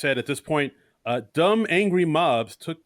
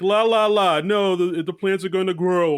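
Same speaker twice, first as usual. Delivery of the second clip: higher, louder airy la-la mockery; hammed-up fancy voice; mock fancy pleader; 6 semitones higher, 4 dB louder than usual. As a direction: mimic someone being all fancy, pitched up. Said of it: "he was all, like, fancy".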